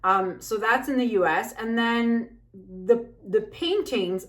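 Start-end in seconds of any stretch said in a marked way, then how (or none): none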